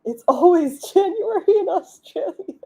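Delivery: high, laughing joke; squeaking through real laughter. Real, not acted. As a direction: crack up midway through the line. Cracking up at own joke.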